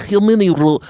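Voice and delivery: high, loud mock Russian accent; comic Russian caller; Vladimir Putin. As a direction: start a hammed-up Russian accent. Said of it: "it's Vladimir Putin getting on the phone".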